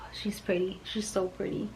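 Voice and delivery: hushed, awed whisper; barely voiced, soft gushing; admiring whisper. Real, not acted. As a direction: whisper it softly in adoration. Awed whisper over the pretty bottle.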